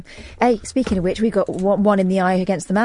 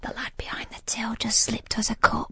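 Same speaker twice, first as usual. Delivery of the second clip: whispering; 10 dB quieter than usual. Pretend. Secret about free coffee.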